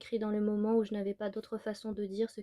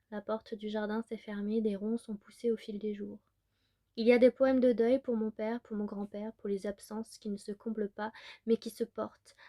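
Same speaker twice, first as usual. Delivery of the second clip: usual speaking voice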